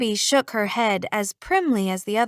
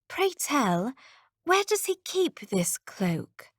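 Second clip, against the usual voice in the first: arch courtly lady; 4 semitones higher, 5 dB quieter, slower than usual. Acted. Elizabeth's archaic mock question.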